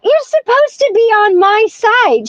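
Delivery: high-pitched mock-indignant whine of betrayal; indignant protest. Granddaughter protesting to her granny, high retold indignation. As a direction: protest high, as if let down by an ally.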